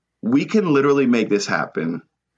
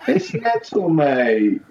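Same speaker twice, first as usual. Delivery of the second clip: mock British accent; 6 semitones higher, much slower than usual.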